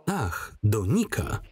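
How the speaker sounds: slow, drawn-out mocking repeat; mocking echo. He mocks her answer with a slow, sneering echo. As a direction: toy with her words slowly.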